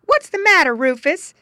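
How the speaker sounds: high, loud calling wife; high loud farm wife voice; farmer's wife calling. A narrator's reading. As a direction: call across the farmyard to her husband. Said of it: "asked the farmer's wife".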